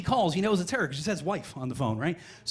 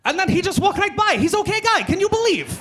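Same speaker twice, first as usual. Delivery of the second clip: high, loud mock Croatian accent; amazed Croatian storyteller; 13 semitones higher, 7 dB louder than usual. Croatian reveals the story's punchline to an American, in loud accented amazement. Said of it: "the punchline of the story is like".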